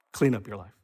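low, soft drop in voice; gentle afterthought. Acted. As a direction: let it fall quietly as a mere result. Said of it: "That's the order".

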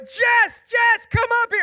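high, loud shout to another room; yelled call. Retold or imitated. Dad calls his wife up at bedtime, yelling. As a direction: re-enact yelling for someone upstairs. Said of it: "I just yelled from the room".

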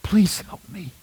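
whispered, broken plea; desperate whisper. Retold or imitated. Desperate person praying to God, whispered plea. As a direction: barely breathe the prayer. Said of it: "in desperation they cry out".

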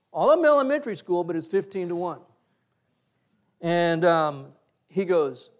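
ordinary delivery

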